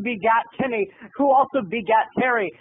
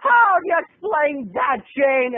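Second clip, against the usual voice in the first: accusing mom; 5 semitones higher than usual. Retold or imitated. Mom confronts her sister about the furniture. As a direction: demand an answer. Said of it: "my mom said".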